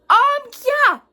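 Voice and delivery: exaggerated mocking yes; sarcastic duh. Real, not acted. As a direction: say it like it's obvious. Sarcastic obviousness.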